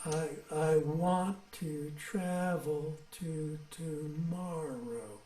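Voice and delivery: very slow, softening line; drawn-out reading. Shown as a demonstration. Teacher modelling the line for the group, very slowly and softly.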